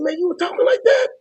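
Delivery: retold disbelief, voice shooting up high; astonished question. Man astonished at his manager's way of talking, incredulous.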